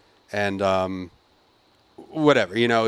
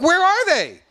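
outraged demand. Retold or imitated. Confronting the hostess again.

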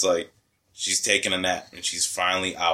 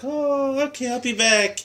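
much higher, breezy and oblivious; mock chirpy mom. Grandma leaving son with assembly mess, chirpy mock voice. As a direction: chirp it like nothing's wrong. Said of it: "And my mom's just like".

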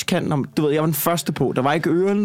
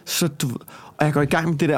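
usual speaking voice

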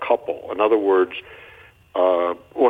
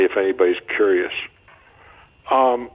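ordinary delivery

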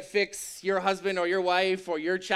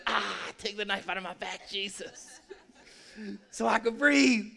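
mock pained gasp; 4 dB quieter than usual. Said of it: "it's kinda like the".

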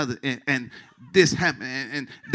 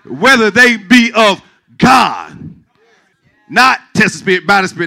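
raised, loud pulpit exhortation; forceful preaching; 5 semitones higher, 9 dB louder than usual. Real, not acted. Pastor warning church against personal biases, forceful preaching. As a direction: drive it home to the whole congregation.

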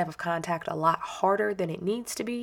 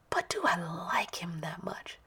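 whisper; 6 dB quieter than usual. Real, not acted. Whispered aside about her kid.

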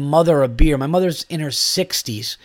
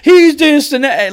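far higher, louder hammed-up wench shout; mock tip shout; 11 semitones higher, 7 dB louder than usual. Imitating the cheer the lady refused to give, hammed-up shout. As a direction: yell it like a fair barmaid hollering. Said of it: "she didn't give the whole".

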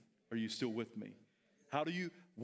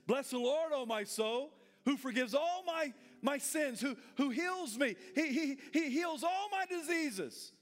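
bold declaration; 9 semitones higher than usual. Retold or imitated. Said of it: "He had a powerful, bold declaration".

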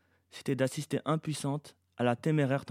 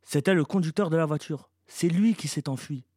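higher, louder defensive reply; self-justifying; 5 semitones higher, 5 dB louder than usual. A narrator's reading. Eleanor explains why she ran off, self-justifying. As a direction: defend yourself insistently. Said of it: "se justifia Eleanor".

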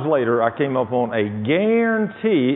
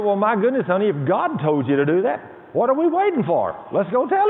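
much higher, rising with eager excitement; wife urging him to go now, climbing higher; 8 semitones higher than usual. Retold or imitated.

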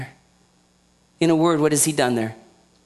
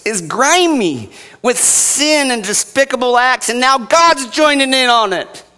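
louder, higher preaching on justice; fiery preaching; 6 semitones higher, 5 dB louder than usual. Acted. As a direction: drive it home like a revival preacher. Preaching at full force.

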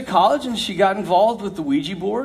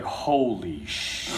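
very low, much softer, slow and cut off; stunned hush; 10 dB quieter, much slower than usual. Retold or imitated. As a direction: breathe it out in shock. Terror seen in girl's eyes.

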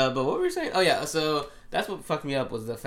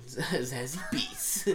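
drawn out; 3 semitones higher, much slower than usual. Pretend. Savouring the name like a rapper.

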